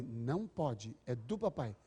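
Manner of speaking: low, quiet, firm no; hushed, low-pitched parent setting a rule; low parental warning. Retold or imitated. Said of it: "É mais sons, tom da voz".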